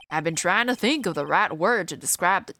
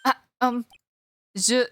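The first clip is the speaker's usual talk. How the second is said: lightly hammed-up fake French accent; terrible French accent; 4 semitones higher than usual. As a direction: play the nervous Frenchman with a bad accent. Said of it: "I love doing terrible French accents".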